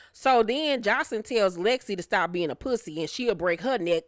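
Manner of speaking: normal talking voice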